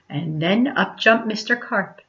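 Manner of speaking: ordinary delivery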